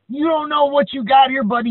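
threatening wrestler. Retold or imitated. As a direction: menace him like a ring villain. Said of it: "he's like".

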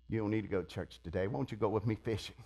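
low, soft, quick coaxing; hushed tempter. Acted. Friend luring churchgoer to go fishing, hushed coaxing. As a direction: slip in the temptation under your breath. Voicing a tempter who hinders.

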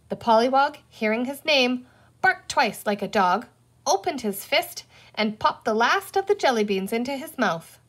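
plain reading voice

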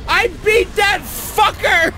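high, loud, slow triumphant yell; victory scream. Real, not acted. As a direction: shout it out in real joy. Finally beats the boss.

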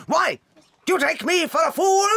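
sharp scolding challenge; scornful headmistress rising in anger; angry demand. Acted. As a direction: berate the child, voice climbing. Headmistress berating pupil.

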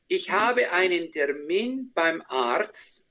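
muy despacio, sílaba a sílaba; lento y claro. Shown as a demonstration. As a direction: modelo para que la alumna repita. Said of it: "Dale, por favor". (dicho muy despacio, marcando cada palabra)

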